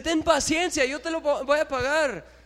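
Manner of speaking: hammed-up servant begging the king; desperate plea. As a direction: cry out to the master for mercy. Servant begs the king again.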